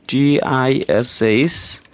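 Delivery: letters spelled out one by one, very slowly; spelling out letters. Shown as a demonstration. Teacher spelling out letters slowly for students. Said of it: "ব্রিটিশ".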